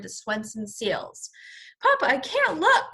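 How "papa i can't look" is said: high, loud scared cry; children crying out. Acted. The children cry out to Papa in fright, high and loud. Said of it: "cried the children".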